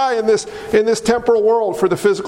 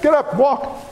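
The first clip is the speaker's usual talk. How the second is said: firm, sure command. Retold or imitated. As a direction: say it with full authority. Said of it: "He didn't say it like that".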